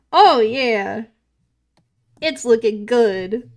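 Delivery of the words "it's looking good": normal talking voice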